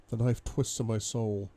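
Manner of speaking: plain reading voice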